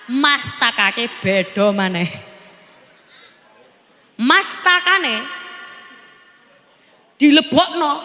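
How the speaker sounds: says "mastakake" and "dilebokno" slowly in local Javanese; slow, low Javanese wording; Javanese pronunciation. Shown as a demonstration. Preacher shows the local Javanese words, slow and low. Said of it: "bahasa halus itu mustoko".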